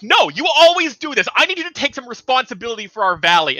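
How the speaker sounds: high, loud, quick scolding; exasperated titan wife. Acted. Titan wife bickering with husband.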